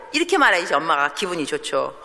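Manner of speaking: ordinary talk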